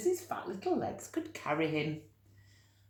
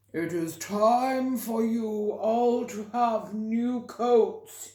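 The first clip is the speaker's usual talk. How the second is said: loud, higher, slow pompous voice; pompous elephant; 5 semitones higher, 6 dB louder, slower than usual. A narrator's reading. Elephant addressing the gathered animals, pompous and loud. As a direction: boom it out like a self-important elephant. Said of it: "he said".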